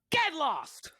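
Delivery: high shouted battle cry; yelling. Acted. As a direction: yell it at him. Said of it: "She just yells out".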